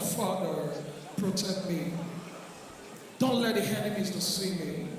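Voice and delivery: very low, soft mock prayer; genteel churchgoer praying under the breath; sweet gentle prayer. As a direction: pray sweetly and quietly, almost murmured. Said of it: "Eh, it is sweet".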